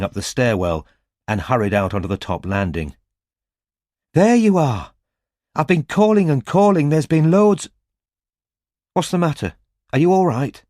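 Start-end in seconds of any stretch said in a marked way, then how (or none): 4.1-7.7 higher, louder anxious call; relieved to finally catch him; a young woman calling out, worried; Robin, urgent. A narrator's reading. 8.9-10.6 higher worried questions; anxious concern for him; voiced as a worried young woman; Robin, worried. A narrator's reading.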